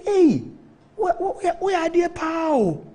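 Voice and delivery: high-pitched, imitated old man's exclamation; mimicking an elder's high, astonished outburst; excited exclamation. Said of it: "Like that, like that, eh?".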